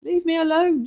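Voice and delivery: high, loud desperate plea; out-of-breath frightened rat; breathless cry. Acted. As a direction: cry it out while fleeing. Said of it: "she cries, trying to catch her breath".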